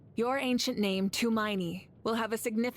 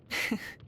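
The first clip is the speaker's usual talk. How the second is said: breathy chuckle. Acted. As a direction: chuckle at being found out. Admits Lolly sees through her.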